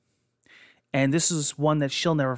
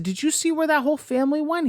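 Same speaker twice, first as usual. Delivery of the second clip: alarmed question; 12 semitones higher, faster than usual. Retold or imitated. Asking stranger, alarmed.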